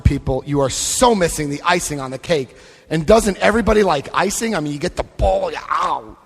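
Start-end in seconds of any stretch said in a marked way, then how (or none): none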